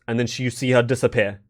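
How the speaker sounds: ordinary talk, nothing marked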